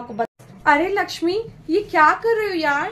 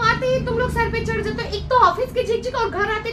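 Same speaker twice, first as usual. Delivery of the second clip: put-on raised, higher voice snapping at the kid; angry scolding; 7 semitones higher than usual. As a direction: tell the child off, fed up after work. Tired mother scolds her child. (थकी माँ बच्ची को डाँटती, ऊँची गुस्से वाली आवाज़)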